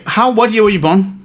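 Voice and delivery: ordinary talk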